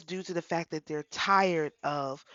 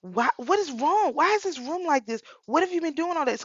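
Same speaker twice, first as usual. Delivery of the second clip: high, loud exasperated scolding; retold yelling; 10 semitones higher, 4 dB louder than usual. Mother to middle daughter over the messy room, yelling. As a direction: pitch it right up, acting out a parent's yell. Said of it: "I started yelling at her".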